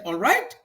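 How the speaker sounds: "All right" lifted high into a question; high rising "All right" on the final word; simply asking. Shown as a demonstration. Teacher showing how to simply ask, rising question. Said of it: "simply ask, nothing else".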